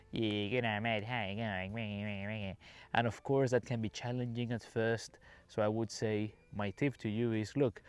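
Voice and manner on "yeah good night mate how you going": slow, slurred Australian mumble, exaggerated; tough Aussie accent. Shown as a demonstration. Showing learners what they will hear, exaggerated tough Aussie accent. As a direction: drag it into a thick, garbled Aussie accent. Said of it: "real tough Aussie accent".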